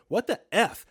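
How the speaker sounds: high startled yell. Retold or imitated. Punched awake, she yells at boyfriend in shock. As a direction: yell it, rudely woken by a punch. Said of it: "sat up in bed yelling".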